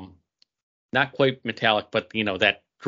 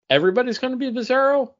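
excited; 14 semitones higher, 4 dB louder, faster than usual. Real, not acted.

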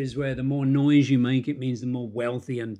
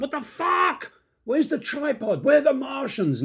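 bewildered outburst; 11 semitones higher than usual. Retold or imitated. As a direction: exclaim in exaggerated bewilderment. Acts out bewildered reaction in car.

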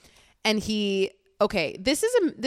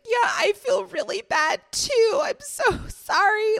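put-on much higher, slow, sing-song shouted girly voice; imitating Julia; 14 semitones higher, 3 dB louder than usual. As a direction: ham up her sweet clueless apology. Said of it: "She's like".

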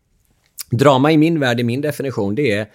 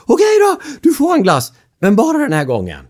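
much higher, louder panicky caving; panicked giving in; 13 semitones higher, 6 dB louder than usual. Retold or imitated. Father caves to yelling son, high and loud. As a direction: give in in a rush of panic. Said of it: "jag klarar inte av när någon höjer rösten".

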